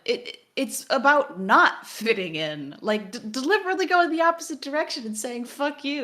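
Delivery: incredulous defiant retort; exasperated retort. Retold or imitated. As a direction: correct them with mounting exasperation, loud and high. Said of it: "And I'm like".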